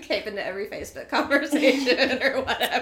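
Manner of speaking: speaking through laughter; laughing. Real, not acted. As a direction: laugh out the words.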